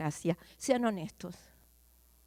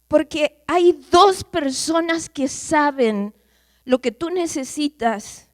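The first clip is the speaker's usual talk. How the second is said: slow emphatic preaching; 5 semitones higher, 11 dB louder, slower than usual. Acted.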